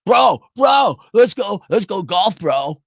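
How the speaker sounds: loud, high-pitched hyped-up bro shout; mock golf bro. Boss mockingly rallying a buddy to go golf, hammed-up bro shout. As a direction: whoop it up like an over-excited buddy. Mocks boomer bosses' golf invitation.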